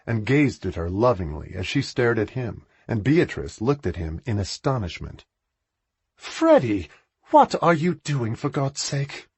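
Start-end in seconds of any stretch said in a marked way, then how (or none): 6.1-9.3 exasperated. A narrator's reading. Sister to brother kneeling, exasperated raised voice. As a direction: voice Beatrice's disbelief at her kneeling brother.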